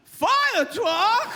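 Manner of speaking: high, loud delighted cry; excited passenger. Retold or imitated. Passenger in back thrilled by fire truck, loud excitement. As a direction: burst out, pitch shooting up. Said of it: "one guy in the back of the plane went".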